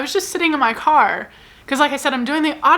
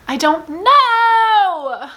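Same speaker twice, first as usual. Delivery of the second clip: drawn-out, higher, whiny cry of I don't know; exasperated whine; 9 semitones higher, 4 dB louder, much slower than usual. Real, not acted. Torn between two books, a slow exasperated whine. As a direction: wail it out in mock despair. Exasperated over pausing a book.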